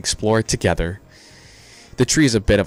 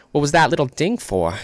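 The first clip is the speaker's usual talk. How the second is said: put-on higher-pitched, clumsy southern accent; bad southern accent; 7 semitones higher, 3 dB louder than usual. Asking about the watch's ding, in a botched southern accent. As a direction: attempt a southern accent, badly. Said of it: "how do you even do a southern accent".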